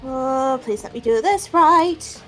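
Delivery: usual speaking voice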